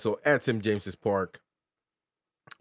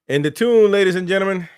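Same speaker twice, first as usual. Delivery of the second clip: higher, louder announcer call; excited hype; 8 semitones higher, 7 dB louder, faster than usual. Real, not acted. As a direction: call it out to the crowd. Hyping the club's win.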